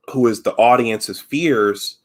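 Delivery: ordinary talk, nothing marked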